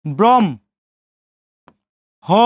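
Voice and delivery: ব্রহ্ম as "brom", long pause, then "ho"; m first, h after in ব্রহ্ম; split pronunciation. Shown as a demonstration. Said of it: "এবং হ উচ্চারণ করব".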